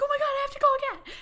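fast, very high squeal; retold panic. Telling her partner she must call Kat, frantic. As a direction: gabble it in excited mock panic.

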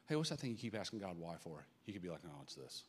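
ordinary delivery

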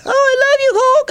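put-on very high little boy's voice; squeaky kid voice. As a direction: gush like a small fan. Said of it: "He's like".

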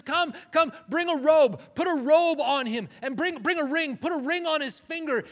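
loud and high, calling the servants with joy; joyful calling out. Retold or imitated. Father calling his servants to celebrate, loud and joyful. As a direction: call out to the household in delight.

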